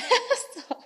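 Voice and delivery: high, embarrassed giggle; nervous laugh. Real, not acted. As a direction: laugh off the nerves. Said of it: "Sorry, I'm a bit nervous".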